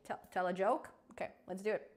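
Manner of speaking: ordinary delivery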